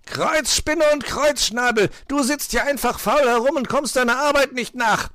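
loud, high fox outburst; furious snarling. A narrator's reading. Fox berates lazy Pechmarie, furious snarling. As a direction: scold at the top of the voice. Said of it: "fauchte er sie an".

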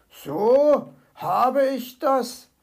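slow, drawn-out mild grumble; lightly voiced grumbling fox. A narrator's reading. Fox doubting the magpie's words, grumbling. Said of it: "brummte er".

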